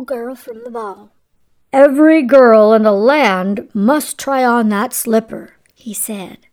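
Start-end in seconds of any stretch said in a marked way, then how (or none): 1.7-5.5 loud, declaring storybook prince; royal proclamation. A narrator's reading. Prince decrees a slipper search across the land, proclaiming. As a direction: announce it loudly to the whole kingdom.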